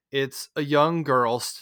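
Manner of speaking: ordinary talk, nothing marked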